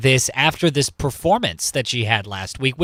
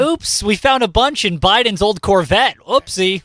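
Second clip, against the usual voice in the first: higher-pitched, sing-song sarcasm; mock innocent oops; 6 semitones higher than usual. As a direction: feign a silly accidental discovery. Joins in the mocking announcement.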